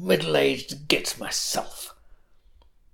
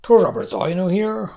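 plain reading voice

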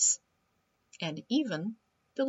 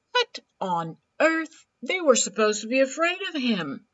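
shocked scarecrow; 8 semitones higher, 8 dB louder than usual. Acted.

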